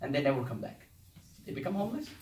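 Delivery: usual speaking voice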